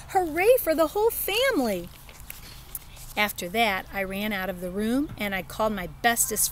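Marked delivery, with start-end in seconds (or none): 0.1-1.9 joyful shout. Acted. Kid cheering family news.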